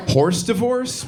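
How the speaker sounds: "horse divorce" sounded out as English words, pitched high; baffled literal misreading. Acted. First sight of hors d'oeuvres on paper, misread as a high, puzzled "horse divorce". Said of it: "first time you saw hors d'oeuvres on paper".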